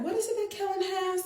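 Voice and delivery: put-on high, quick little boy's voice; son's voice. Mother imitating her son asking about his friend, high childlike voice. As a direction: pitch it up like a curious young boy asking.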